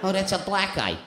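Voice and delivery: normal talking voice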